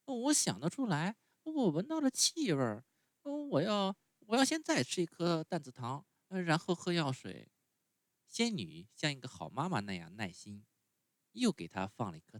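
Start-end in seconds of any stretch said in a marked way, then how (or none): 0.1-7.5 put-on much higher, whiny little boy; puppet boy voice. A narrator's reading. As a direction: sulk like a spoiled child refusing medicine. Pinocchio voiced in story.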